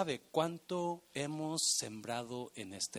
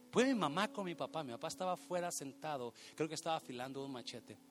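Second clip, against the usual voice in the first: ordinary talk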